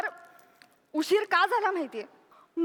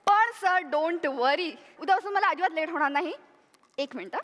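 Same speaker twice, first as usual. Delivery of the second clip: ordinary talk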